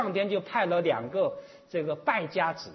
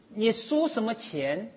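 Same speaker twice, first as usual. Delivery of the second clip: ordinary delivery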